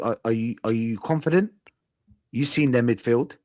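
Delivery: usual speaking voice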